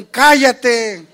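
loud high bark; loud shout. Shown as a demonstration. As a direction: shout it hard. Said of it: "va a ser un".

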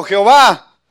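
loud invoking cry of the prophet; loud call to God. Retold or imitated. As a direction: call out the name loudly to heaven. Elijah calls out to God.

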